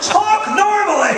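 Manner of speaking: high, slow exasperated yell; exasperated shout. Acted. Comic telling newscasters to drop the fake voice, exasperated shout. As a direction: holler it at the offending newscasters.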